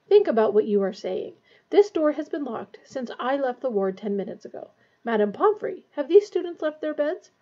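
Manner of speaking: usual speaking voice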